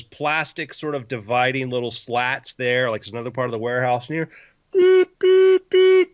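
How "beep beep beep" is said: forklift beeping. Retold or imitated. Imitating forklift reverse alarm.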